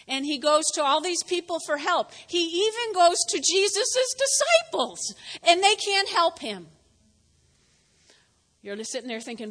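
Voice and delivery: ordinary talk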